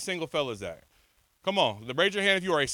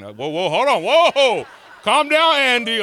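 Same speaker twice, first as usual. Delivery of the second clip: 1 startled shout; 7 semitones higher, 10 dB louder, slower than usual. Real, not acted. Linebacker charges at him early.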